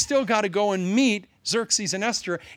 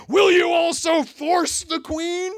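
much higher, louder, slow furious shout; enraged king; 12 semitones higher, 5 dB louder, slower than usual. Acted. King shouting at Haman clinging to the queen. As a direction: roar it out at him in outrage.

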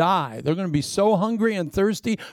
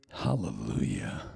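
slow, much lower, softer hallelujah; low drawn-out hallelujah; 9 dB quieter than usual. Acted. Preacher to congregation, a slow low hallelujah. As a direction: sink it down slowly.

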